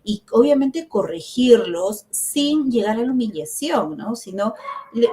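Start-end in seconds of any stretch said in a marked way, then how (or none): none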